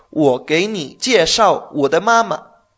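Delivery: wo gei ni jieshao wo de mama slow and clear; slow example. Shown as a demonstration. Teacher gives a slow example sentence. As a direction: read the example slowly. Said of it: "Por ejemplo".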